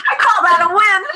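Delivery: said through high laughter; a proud punchline laughed out; laughing. Real, not acted. Coach telling podcast host the story, laughing.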